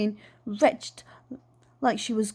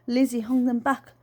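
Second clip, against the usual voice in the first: usual speaking voice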